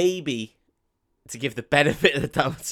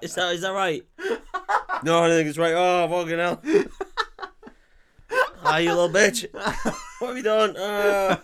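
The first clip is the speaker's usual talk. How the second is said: laughing mock dying; 6 semitones higher, 3 dB louder than usual. Tom voiced in pain, laughing.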